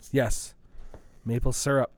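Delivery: ordinary talk, nothing marked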